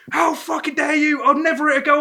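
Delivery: high-pitched retold outrage; mock indignation. Drunk bloke denying he elbowed her, mock outraged. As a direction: play the offended drunk.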